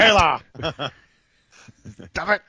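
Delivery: high, sharp mock scolding; scolding dad. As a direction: snap at her like a stern dad. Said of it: "He's like".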